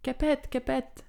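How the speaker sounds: high, slow, drawn-out call; a mother's clear call across pastures. A narrator's reading. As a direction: call out in a clear voice to a child far off. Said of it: "lançait sa voix claire".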